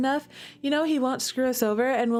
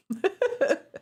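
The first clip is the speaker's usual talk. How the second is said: bashful high-pitched giggle; 10 semitones higher than usual. Real, not acted. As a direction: giggle after confessing a crush. Flirty giggle.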